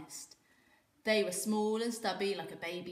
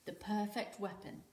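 ordinary delivery, nothing marked

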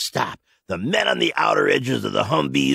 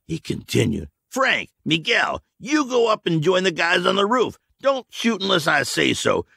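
raised, firm orders; commander orders; 8 semitones higher than usual. A narrator's reading. Michael to his squads, firm raised orders. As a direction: assign each man his post.

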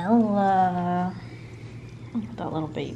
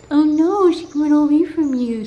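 much higher and louder; excited outcry; 9 semitones higher, 8 dB louder than usual. Real, not acted. Reacting as one cat leaves the other, loud and high. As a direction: burst out in alarm for the cat.

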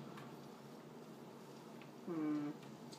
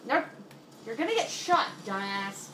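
raging gamer; 3 semitones higher, 7 dB louder than usual. Real, not acted. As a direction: yell at the screen. Yelling at game character.

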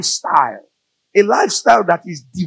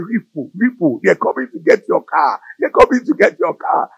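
taunting devil; 6 semitones higher than usual. Acted.

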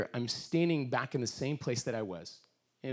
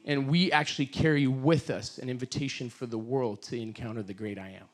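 usual speaking voice

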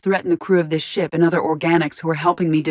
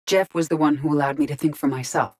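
ordinary talk, nothing marked

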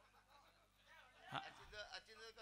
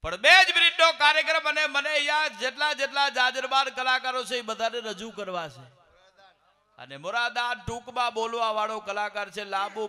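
booming announcement over the crowd; loud stage call; 4 semitones higher, 13 dB louder than usual. Real, not acted. Host shouting the running order to the audience, loud and high. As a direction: project it to the whole hall, voice high and loud.

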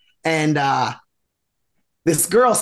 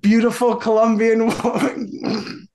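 laughing; 4 semitones higher than usual. Real, not acted. Teller cracks up at his ending.

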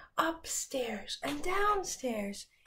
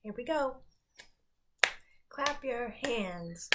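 normal talking voice

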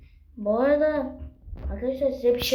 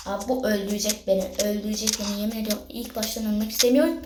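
ordinary talk, nothing marked

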